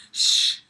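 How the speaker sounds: loud hissing shush; a sharp shush sound. Retold or imitated. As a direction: hush someone like the logo man. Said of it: "he would be waiting ... going".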